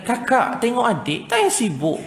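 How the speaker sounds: high-pitched mock motherly order; nagging mother. Mother telling the eldest to watch the little one, mock nagging. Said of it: "Terus mak pula".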